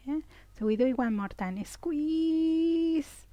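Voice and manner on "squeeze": high, long-held squeeze; sustained high-pitched straining cue. Shown as a demonstration. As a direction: stretch the word out high, as if tensing hard. Coaching tense-and-release exercise.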